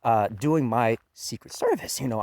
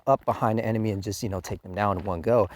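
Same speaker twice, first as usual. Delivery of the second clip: ordinary delivery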